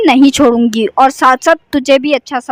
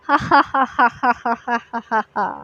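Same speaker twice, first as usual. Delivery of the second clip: evil witch cackle; 3 semitones lower, 7 dB quieter than usual. Acted. Witch cackles at scared class.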